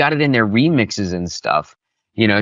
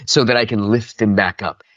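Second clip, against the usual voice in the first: usual speaking voice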